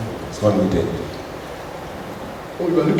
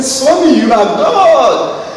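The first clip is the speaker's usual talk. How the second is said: eager calling out; 8 semitones higher, 5 dB louder, slower than usual. Retold or imitated. Student calling out to Swami.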